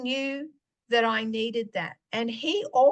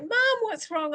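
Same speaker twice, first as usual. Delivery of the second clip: daughter's worried question; 10 semitones higher than usual. Retold or imitated. Daughter voiced in story.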